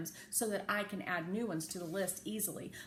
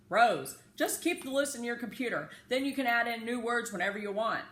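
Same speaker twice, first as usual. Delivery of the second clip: put-on raised, eager boy's voice, louder and higher; Josh's advice; 4 semitones higher, 7 dB louder than usual. A narrator's reading. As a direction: call it out like a bossy classmate. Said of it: "said to me last week".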